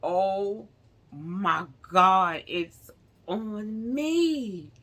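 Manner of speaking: recoiling in disgust at the stain on her shoulder; horrified shriek. Retold or imitated. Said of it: "So I was like".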